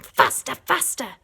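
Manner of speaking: shouting. A narrator's reading. Boggis shouting at the tractor drivers, urgent. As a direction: urge the drivers on. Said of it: "and shouting".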